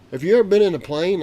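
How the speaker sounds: loud, high question to the grandson. Retold or imitated. Grandpa asks his grandson loudly in the restaurant. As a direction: ask it out loud across the restaurant. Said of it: "'cause he's talking real loud".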